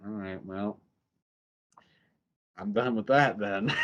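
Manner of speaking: low, subdued retold grumble; mock displeasure. Charles not liking Mabel's remark, mock displeasure. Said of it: "Charles did not like that".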